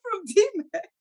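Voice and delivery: squeaky high laugh through the words. Real, not acted. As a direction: crack up mid-sentence. Laughing at own joke.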